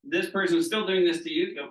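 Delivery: ordinary delivery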